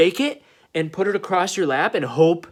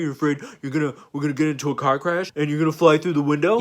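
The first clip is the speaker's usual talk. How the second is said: mocking macho driver. Buddy mocking Marty about his seatbelt, jeering. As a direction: taunt him like a bully buddy in the car. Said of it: "then they'll be like".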